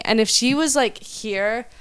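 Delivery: ordinary talk, nothing marked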